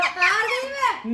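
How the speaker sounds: laughing. Real, not acted. Gives up laughing, mouth burning.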